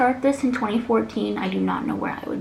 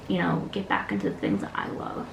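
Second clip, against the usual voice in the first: ordinary talk, nothing marked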